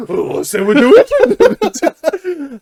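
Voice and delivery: high, loud, slow hammed-up delight; mock eager. Host imitates himself seizing on a hinted accent, mock eager. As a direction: play it like a gleeful accent fan. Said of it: "I'm gonna go".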